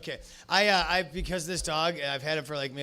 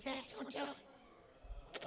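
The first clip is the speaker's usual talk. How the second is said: soft, slow, breathy little dog pleading; whispered dog plea; 10 semitones higher, 18 dB quieter, much slower than usual. Pretend. Dog begging his owner not to murder, slow whisper. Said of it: "he goes".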